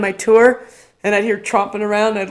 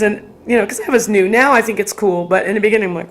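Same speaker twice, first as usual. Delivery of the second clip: usual speaking voice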